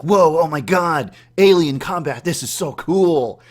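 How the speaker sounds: loud, high, slow gasp of wonder; awestruck excitement. Retold or imitated. Dreamer gaping at alien armies, awestruck and loud. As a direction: marvel out loud at the battle. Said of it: "And I'm like".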